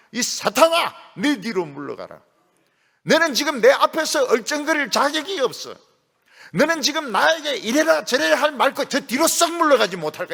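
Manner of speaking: preacher shouting Jesus's rebuke at Peter; furious rebuke. Retold or imitated. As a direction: drive Satan back with a roar.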